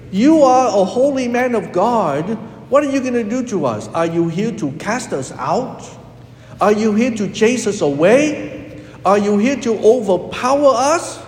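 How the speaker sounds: defiant demons. Retold or imitated. Priest imitating what demons did not say, defiant. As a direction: hurl the questions like bold demons. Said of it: "the demons did not say".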